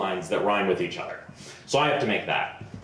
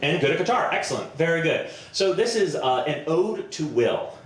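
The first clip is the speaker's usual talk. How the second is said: ordinary delivery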